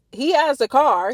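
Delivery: normal talking voice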